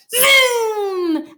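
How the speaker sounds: high, drawn-out zoom; long, high-pitched whoosh of a word; zooming noise. A narrator's reading. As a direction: let the zoom ring out like a racing engine. Acting out the speeding wheelchair.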